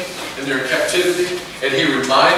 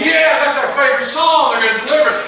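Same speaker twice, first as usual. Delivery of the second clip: much higher, louder cheering crowd; excited crowd; 10 semitones higher, 7 dB louder than usual. Retold or imitated. People cheering their favorite song, higher and louder. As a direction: shout it out like a fired-up congregation. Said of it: "they're going".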